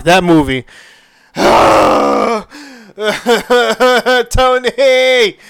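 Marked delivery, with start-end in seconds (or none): 1.4-5.4 hammed-up high sob on the name; mock crying. Fan reliving the end of Endgame, exaggerated tearful wail. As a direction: imitate a shuddering wail of movie grief. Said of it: "I definitely cried at the end of Endgame".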